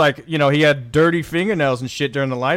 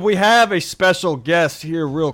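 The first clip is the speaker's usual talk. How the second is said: announcer; 3 semitones higher, 4 dB louder than usual. Acted. Host announces special guest.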